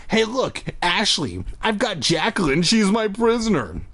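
put-on high-pitched gloating kid; singsong big-brother tease; taunting. A narrator's reading. Brother taunts sister in story.

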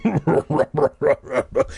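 evil monster laugh; rumbling monster chuckle; deep rumbling laugh. Acted. Masked sister bursts out laughing, deep rumble. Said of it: "came out in a deep rumble".

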